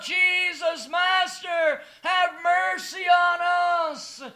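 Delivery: high, loud cry for mercy; loud pleading shout. Acted. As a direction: call out from afar for mercy. Lepers crying out to Jesus.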